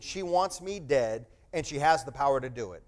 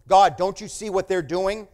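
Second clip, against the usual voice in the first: louder, higher protest shaken at God; a fist raised at heaven, loud and higher; loud, higher indignant cry to God; 6 semitones higher, 12 dB louder than usual. Retold or imitated. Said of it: "shaking his fist going".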